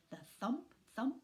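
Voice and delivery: usual speaking voice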